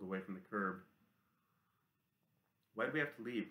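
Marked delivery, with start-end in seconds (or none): 2.7-3.5 mildly demanding question, pitched higher. A narrator's reading. Boy demanding to know why they must leave, mildly insistent. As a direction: demand an explanation, voice lifted. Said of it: "demanded Goodenow".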